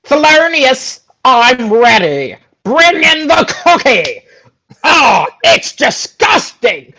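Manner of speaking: high, pompous villain king voice; haughty high stage villain addressing his guards; Lord Farquaad voice. Acted. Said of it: "this was my Farquaad in the show I did".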